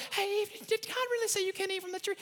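high, mocking devil voice. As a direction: tease Eve in a sing-song high voice. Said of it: "Remember the devil".

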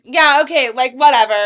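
loud dismissive brush-off; cocky. Retold or imitated. Friend retelling Cheyenne at the bar, loud and cocky. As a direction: wave him off, cocky and loud. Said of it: "she got cockier".

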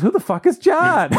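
very high-pitched mock outrage; mad girl. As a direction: imitate a furious girl, voice shooting way up. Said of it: "She was just mad at me".

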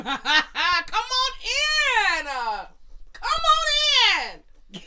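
very high and loud, slow, laughing come on in; giddy welcome. Retold or imitated. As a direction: sing out a flirty laughing invitation. Retelling inviting him in.

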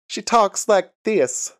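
mock Alpha's Southern twang, slowly; Southern twang impression. Podcast host imitates Alpha's Southern voice, slow and high. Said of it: "I thought that would be a shitty impression".

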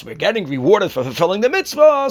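ordinary delivery

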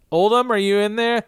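high-pitched call through a door; calling to someone inside. Acted. Recapper as a visitor calling into the teepee, high and searching. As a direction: call out high, checking who's home.

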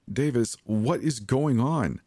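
high, slow, frightened plea for answers; fearful. A narrator's reading. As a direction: draw the question out in fear. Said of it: "The fear vibrated in my throat".

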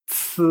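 z as "tsʰ" with a burst of air; z hissed out as a strongly aspirated ts; aspirated Chinese C. Shown as a demonstration. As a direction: explode air through the ts of z, tongue up. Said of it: "notar una explosión de aire".

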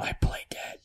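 whisper. Real, not acted. Whispering his move at the table.

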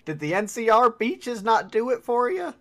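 high, loud mocking question; high-pitched, jeering query; needling game character. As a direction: tease in a pushed-up character voice. Same character repeats the taunt.

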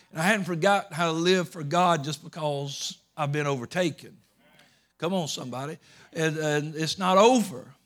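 rally the church from the pulpit; preaching at full force. Acted.

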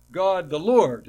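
"God the Lord" said loud, falling on "Lord"; loud quoted title. Shown as a demonstration. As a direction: proclaim "God the Lord" firmly. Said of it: "it shows up again and says".

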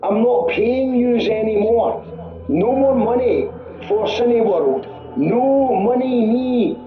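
very slow, every word spelled out; slow and over-clear. Acted. Caller told to speak clearly, slows right down. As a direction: drag each word out slowly for a listener who can't follow. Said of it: "You need to speak more clearly".

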